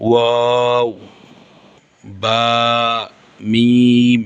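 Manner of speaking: মিম, বা, ওয়াও each held long, lips closed then rounded; Arabic lip letters. Shown as a demonstration. Teacher drilling the lip letters for students, drawn out. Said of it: "এই তিনটি হরফ দুই ঠোঁট থেকে".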